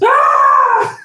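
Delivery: sudden high shriek of terror; terrified scream. Acted. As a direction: jolt awake and shriek. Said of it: "he screams".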